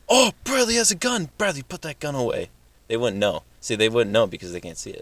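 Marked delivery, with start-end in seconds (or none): 0.1-2.5 mock alarm. Mock alarm about a gun.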